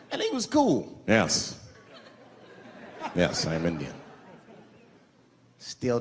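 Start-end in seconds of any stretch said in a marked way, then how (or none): 1.1-3.9 low, slow grave reply; lightly stoic man. Retold or imitated. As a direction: answer with quiet dignity. Stoic Native man answers.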